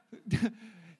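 a quick real laugh at her own joke; short laugh. Real, not acted. Pastor laughing at her own toilet line to the congregation. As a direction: let a brief laugh slip out.

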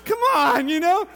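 loud, laughing come on; mock exasperated laugh; laughing protest. Real, not acted. Laughing at the perfect babysitter.

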